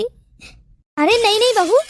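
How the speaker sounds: normal talking voice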